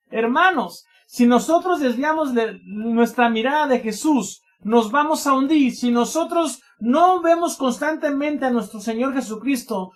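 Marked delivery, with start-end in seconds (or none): none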